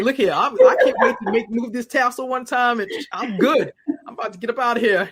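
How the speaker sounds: eager, laughing, fast brag about leaving school; mock-cocky student rushing out the door; cocky young self. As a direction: toss it off like a student itching to graduate. Retold cocky grad-school self.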